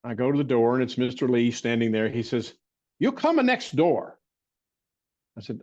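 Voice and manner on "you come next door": higher, slow fond imitation of an old healer; silly voice. Recalling the barefoot doctor at his bedside, in a higher silly voice. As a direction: lift the voice up and take it slowly. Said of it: "the silly voice I'm doing".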